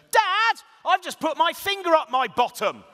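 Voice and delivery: put-on high, loud, gleeful kid shout; child shouting from the bath. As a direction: yell it like a proud little boy. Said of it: "he suddenly shouts to me".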